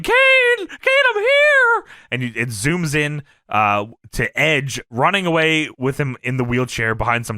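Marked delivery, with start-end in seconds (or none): 0.0-1.8 high falsetto yell, loud; mock a distant cry for help in falsetto; Paul Bearer. Wrestling manager yells to Kane from afar, high wail. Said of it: "Paul Bearer in the distance yelling".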